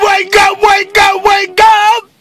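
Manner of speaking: high, loud, drawn-out morning holler; wake-up shout. Pretend. Rousing the viewers at the start of the day, high wake-up shout. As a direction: shout everyone awake at full volume.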